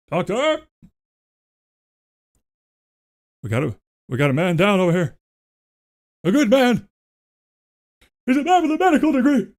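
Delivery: high, fast frantic yelling; man down shout. Acted. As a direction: shout for a doctor in a panic. Acted call for a doctor.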